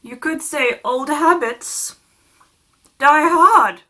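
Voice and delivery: slow, put-on abbot voice building to a loud finish; hammy abbot, punchline belted out; abbot impression. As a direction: play the abbot, drawing out the pun. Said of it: "my impression of the abbot".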